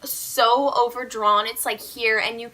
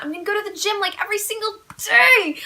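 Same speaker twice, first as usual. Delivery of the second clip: high, gleeful squeal building to the last word; super excited; 8 semitones higher, 5 dB louder than usual. Real, not acted. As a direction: burst out with glee, stretching the end. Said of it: "I'm super excited about that".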